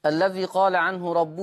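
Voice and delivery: measured chanted recitation of verse; formal recited opening. Acted. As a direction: recite it like a solemn preface. Formal opening and recited didactic poem.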